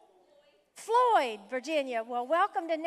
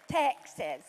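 lower, playful Southern "Texas"; imitated Southern accent; 5 semitones lower than usual. Pastor fondly imitating a guest's accent, playful Southern drawl. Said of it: "Love that Southern accent".

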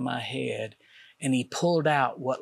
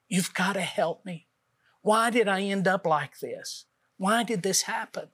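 pleading with God, pitched higher, half-reliving the despair; retold cry for help, voice lifted and strained; a man on the floor begging God for help, pleading; desperate crying prayer; 5 semitones higher than usual. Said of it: "And I just cried".

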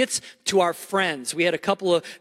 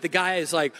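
ordinary talk

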